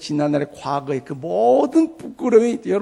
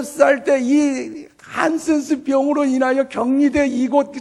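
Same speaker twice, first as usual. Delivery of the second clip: higher, impassioned retold testimony; old woman's testimony; 5 semitones higher, 4 dB louder than usual. Old leprosy patient tells pastor her life, higher impassioned retelling. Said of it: "그 할머니 성도님 하는 말씀이".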